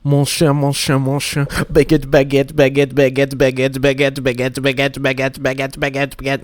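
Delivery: high, hammed-up French accent chant; cartoon French accent, repeated like a song; mock French accent.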